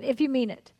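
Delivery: ordinary talk, nothing marked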